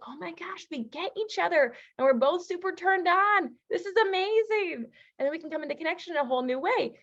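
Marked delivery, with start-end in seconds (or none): 0.1-4.9 high, thrilled gushing; mock excitement. Couple gushing over each other's texts, high excited voice. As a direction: gush like you're both delighted. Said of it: "We're like".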